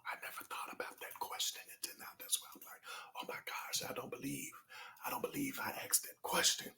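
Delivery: breathy secret whisper, voice gone. Real, not acted. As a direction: whisper it like a shocked confession.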